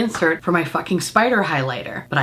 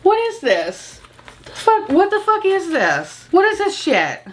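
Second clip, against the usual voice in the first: much higher, slow, stunned repeated questions; shocked disbelief; 9 semitones higher, slower than usual. Retold or imitated. Unboxing the tiny bag, higher and slow in disbelief. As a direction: act out staring at the box in horror. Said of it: "I was like".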